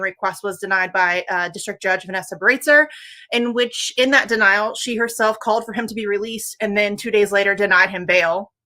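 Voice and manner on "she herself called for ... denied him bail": ordinary talk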